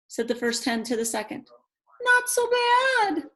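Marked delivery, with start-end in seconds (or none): 2.0-3.3 high, slow clucking hen. A narrator's reading. Second hen agreeing with the first, high and prim. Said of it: "said the second to the first".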